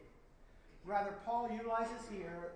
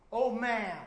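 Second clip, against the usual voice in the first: proclaimed address; 4 semitones higher, 10 dB louder than usual. Shown as a demonstration. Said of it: "you can see the word".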